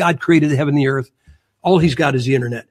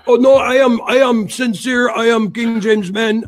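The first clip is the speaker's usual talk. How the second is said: put-on Russian accent; 5 semitones higher than usual. Caller insisting he is sincere, mock Russian accent. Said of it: "He starts with this Russian accent".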